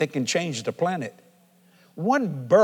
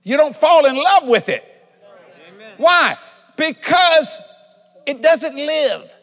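loud, high-pitched sermon delivery; full-force preaching; 7 semitones higher, 7 dB louder than usual. Real, not acted. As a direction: proclaim it to the whole congregation, pounding each point. Preaches at full force about money.

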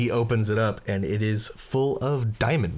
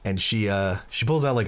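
normal talking voice

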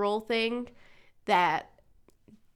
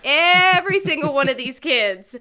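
louder, higher outraged rant; indignant mom; 5 semitones higher, 9 dB louder than usual. Retold or imitated. Mom ranting at cursing kids by the school office, loud indignant. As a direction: vent like a fed-up mom. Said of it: "And I'm just like".

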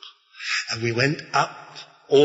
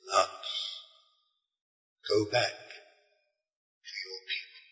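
hushed otherworldly voice; 6 semitones lower, 11 dB quieter than usual. Retold or imitated. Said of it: "Human and not human".

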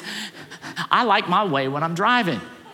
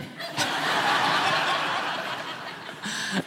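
laugh; 9 semitones higher, 5 dB quieter than usual. Real, not acted. Laughing at the story.

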